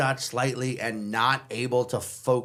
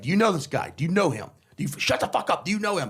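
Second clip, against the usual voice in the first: higher-pitched, heated imitation of a bouncer; retold angry demand; 6 semitones higher, faster than usual. Bouncer kneeling on a drunk questions his friends, retold angry demand. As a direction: fire the question, cutting off the struggler.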